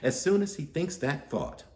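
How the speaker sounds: plain reading voice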